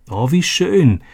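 breathless delight, high; amazed. A narrator's reading. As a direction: gasp it out in wonder. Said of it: "staunte Robby".

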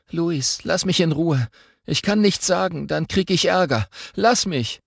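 put-on scared boy voice. Bullied boy begging a classmate to leave him alone, upset. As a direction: beg to be left alone, afraid of trouble. Mark pleading to be left.

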